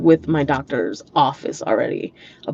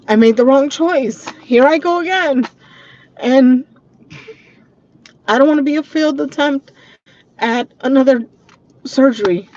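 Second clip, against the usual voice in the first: higher, louder, tearful with pauses; holding back tears; 7 semitones higher, 5 dB louder than usual. Real, not acted. Confessing regret over surgery, trying not to cry. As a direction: push the words out while fighting real tears. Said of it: "I'm trying not to cry".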